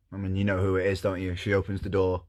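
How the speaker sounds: usual speaking voice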